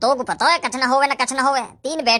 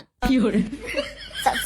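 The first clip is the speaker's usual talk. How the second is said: ordinary talk, nothing marked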